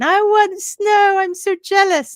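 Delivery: envious excitement. Retold or imitated. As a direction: whine loudly like an envious snow lover. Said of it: "There I was at the beginning going".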